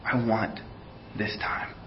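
breathy hush, barely voiced; soft whisper of divine welcome; whispered words of God. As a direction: whisper it like a secret invitation. God's voice imagined, whispered.

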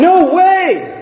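high shouted disbelief; emphatic no way. Real, not acted. Preacher exclaims disbelief.

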